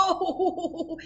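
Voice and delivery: a high, bursting laugh on oh; laughing out loud. A narrator's reading. Rhinoceros laughing at the porcupine's name, loud and high. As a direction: crack up, helpless. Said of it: "he laughed out loud".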